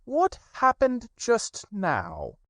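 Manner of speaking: high, slow grave rabbit voice; slow important question; solemn Rabbit. Acted. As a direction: Rabbit the stern judge. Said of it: "he said solemnly".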